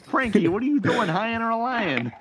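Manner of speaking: high, incredulous New York teamster; poor New York accent. Acted. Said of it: "really poor New York accents".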